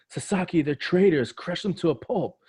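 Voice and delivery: high-pitched cartoon shout; acted battlefield alarm; alarmed call.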